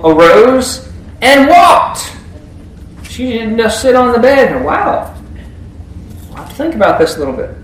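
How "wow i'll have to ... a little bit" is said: mock casual girl. Mock girl's casual reaction.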